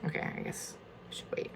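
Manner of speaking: whispered aside to herself; whispering. Real, not acted. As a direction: breathe it out to yourself, barely voiced. Muttering to herself while opening.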